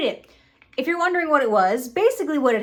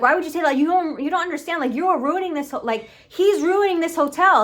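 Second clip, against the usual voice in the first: higher-pitched acted indignation; heated protest; 5 semitones higher than usual. Retelling Charlie arguing over the hotel, heated protest. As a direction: protest it in a rising argument, pitched up. Acted quarrel between show characters.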